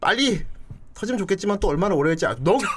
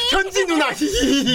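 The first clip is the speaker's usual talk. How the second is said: put-on high, sing-song cutesy girl voice; 9 semitones higher, slower than usual. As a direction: act cute and giggle. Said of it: "어디 귀여운 척을 하고 있어".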